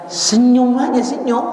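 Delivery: high, loud Senyum aja called out; urging to smile. Acted. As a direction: call it out bright and lifted. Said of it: "urging congregation to smile".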